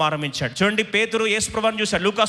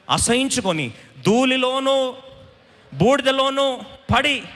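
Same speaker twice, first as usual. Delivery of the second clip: slow, high, drawn-out scripture cry; declaimed repentance; 6 semitones higher, slower than usual. Acted. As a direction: proclaim each phrase slowly at raised pitch. Preacher declaims Job's repentance verse. (నెమ్మదిగా, ఎత్తైన స్వరంతో పశ్చాత్తాప పఠనం)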